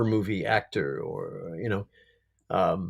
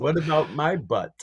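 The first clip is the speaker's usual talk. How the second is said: put-on Death's Czech accent; 4 semitones higher than usual. Quoting Death's line in accent.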